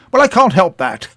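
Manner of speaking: famous broadcaster haughtily refusing to wait for the clerk; pompous brush-off. Retold or imitated. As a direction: sniff it off as a grand man who won't wait. Pompous customer dismissing clerk.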